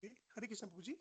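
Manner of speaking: barely audible, far from the mic; faint greeting, almost lost; too quiet. Real, not acted. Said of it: "Your voice is very low".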